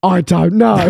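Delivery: comic flustered holler; mock-panicked shout. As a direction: yell it as if put on the spot. Mock panic at quick fire question.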